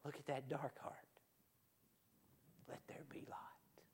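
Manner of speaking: near-whispered divine pity; very soft, spoken almost on breath alone; hushed Father. Acted. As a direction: drop right down to a soft, awed whisper. Father gazing into dark heart, acted.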